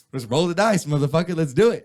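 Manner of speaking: hyped-up bravado. Retold or imitated. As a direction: egg yourself on like a gambler going all in. Chappelle hyped, retold bravado.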